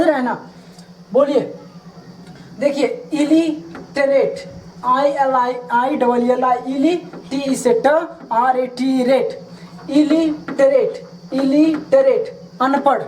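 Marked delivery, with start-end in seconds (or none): 3.1-4.6 illiterate pronounced very slowly, i-li-te-ret; slow model word. Shown as a demonstration. Teacher slowly modelling illiterate for students. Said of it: "teacher models word slowly". 9.9-12.4 illiterate said slowly, every syllable clear; slow repetition. Shown as a demonstration. Teacher repeating illiterate slowly for students. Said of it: "teacher models word slowly".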